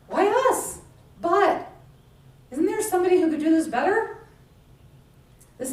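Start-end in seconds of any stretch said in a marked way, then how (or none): 0.1-4.3 higher, louder pleading doubt; voicing our own excuses; doubting believer. Congregation's self-doubt voiced.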